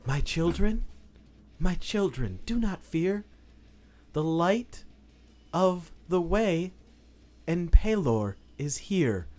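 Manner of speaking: gentle, soothing voice. Acted. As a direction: comfort a flock with measured pauses. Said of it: "this gentle, soothing voice".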